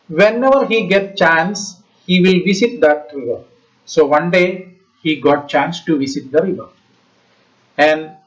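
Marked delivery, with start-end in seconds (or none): none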